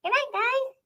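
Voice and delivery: tiny high-pitched pet voice; Marty. Pretend. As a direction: squeak it out high and soft. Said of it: "that cannot be Marty's voice".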